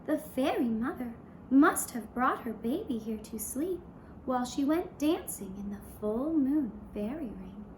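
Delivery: ordinary delivery, nothing marked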